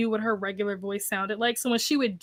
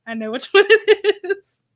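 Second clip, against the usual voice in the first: louder as the laugh takes over; amused retelling, cracking up; laughing retold reaction; 8 semitones higher, 7 dB louder than usual. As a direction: break into laughter mid-quote. Laughing over video caption.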